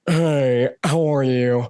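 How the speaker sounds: drawn-out, drawling movie-star greeting; lazy, stretched-out celebrity impression; Nicolas Cage. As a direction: impersonate a laid-back eccentric star. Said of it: "Nicolas Cage comes in".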